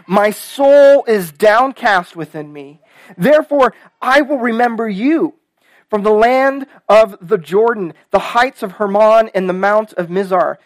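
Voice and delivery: strong, impassioned psalm reading; emphatic pulpit reading; scripture reading. Acted. Reads the psalm aloud.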